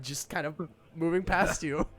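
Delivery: usual speaking voice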